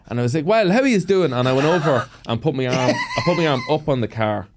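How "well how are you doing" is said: put-on high, loping rural Cavan accent; chirpy-pitched country Cavan lilt; ramped-up Cavan accent. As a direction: lay the Cavan accent on thick. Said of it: "I used to ramp up the accent".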